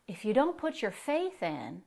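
ordinary delivery

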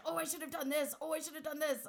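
mildly exaggerated second-guessing; mock self-reproach. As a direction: replay your own regrets, lightly hammed up. Mimics own past second-guessing.

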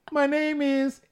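high, loud falsetto reading; put-on pleading reader voice. Host reading the man introducing himself, squeaky put-on voice. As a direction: keep up the squeaky begging voice. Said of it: "I gotta get the voice".

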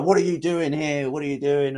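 indignant challenge. Retold or imitated. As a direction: catch a trespasser in the act.

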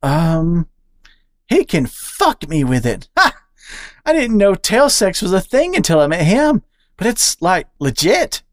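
high, bouncy squirrel voice; excitable squirrel. Acted. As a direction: gossip eagerly to the new friend. Charlie bragging cheekily.

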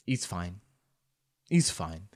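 put-on low, very quiet Eastern European accent. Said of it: "And he goes".

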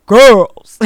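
dad's loud, commanding one-word call; deep baritone dad. Retold or imitated. Dad calling daughters from the couch, loud and commanding. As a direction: boom it out like a father everyone obeys. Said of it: "with his deep baritone voice".

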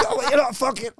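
high, put-on voice of a puzzled audience man; mock baffled bloke. Audience man puzzled at the dangling comic, high mock voice. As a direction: voice the confused guy who pulled the chair.

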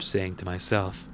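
ordinary talk, nothing marked

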